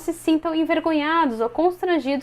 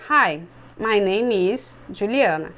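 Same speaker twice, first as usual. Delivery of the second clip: slow and lower, like a nervous student reading the line; student reading from paper, slow and lower; 5 semitones lower, slower than usual. Shown as a demonstration. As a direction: read it stiffly, eyes on the page. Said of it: "vão tentar ler assim".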